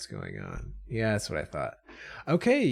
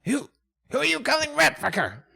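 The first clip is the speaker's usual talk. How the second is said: high, quick rat retort; high-pitched indignant creature; offended rat; faster than usual. Acted. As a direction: squeal back as an insulted rat. Rat answers the insult.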